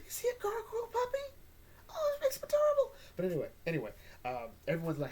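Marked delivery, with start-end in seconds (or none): 0.1-2.9 high squeal over a gargoyle puppy; squealing with delight. Real, not acted. Gushing over a baby gargoyle puppy, squealing. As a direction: coo like you just saw a puppy.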